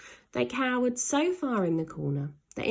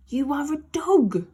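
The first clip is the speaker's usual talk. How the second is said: put-on higher, louder boy's voice; boy Ned; 7 semitones higher, 7 dB louder than usual. Ned urging Slime, higher and louder. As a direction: remind him urgently.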